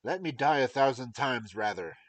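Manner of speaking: loud anguished king; loud despairing king; king's cry. Acted. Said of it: "cried the unhappy king".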